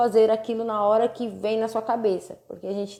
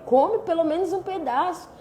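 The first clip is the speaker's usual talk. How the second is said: higher, imitating a mildly cross daughter; daughter half cross; 8 semitones higher than usual. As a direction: mimic the daughter scolding her mother. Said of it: "tipo, meio brava comigo". (imitando a filha meio brava)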